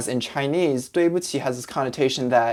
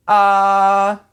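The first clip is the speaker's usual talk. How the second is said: loud long high ah, like at the dentist; 7 semitones higher, 7 dB louder than usual. Retold or imitated. Patient at the dentist, opening wide. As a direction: open wide and say ah. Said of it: "sound you make when you go to a dentist".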